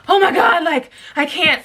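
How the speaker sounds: overwhelmed exclamation while high; overwhelmed outburst. Retold or imitated. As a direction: spill it out, half panic half giggle. Laughing helplessly while panicking.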